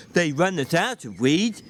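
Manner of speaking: plain reading voice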